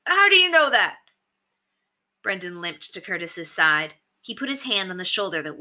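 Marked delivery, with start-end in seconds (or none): 0.1-1.0 high, loud challenge; cried out. A narrator's reading. Alarmed bandit demands proof from the leader, crying out. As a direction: cry it out from the crowd. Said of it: "cried one".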